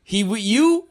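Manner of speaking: a hint of the bouncer calling out; mock security guard. Guard checks on Luke, lightly voiced. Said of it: "he's like".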